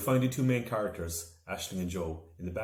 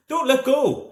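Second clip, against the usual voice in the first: much higher, loud shout over the wind; 12 dB louder, slower than usual. A narrator's reading. Aisling to Joe while flying, shouting over the wind. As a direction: yell it into the gale. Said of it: "Aisling shouted".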